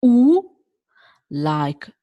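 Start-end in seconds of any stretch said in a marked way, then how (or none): 0.0-0.5 U said as a pure oo, higher and louder; Italian U sound. Shown as a demonstration. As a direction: round the lips for a clean oo. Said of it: "the last one is".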